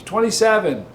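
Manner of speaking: high, sing-out shout; acted yell. Inmate calling out another joke number, high yell. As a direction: call it out like a punchline. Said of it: "He hears somebody yell".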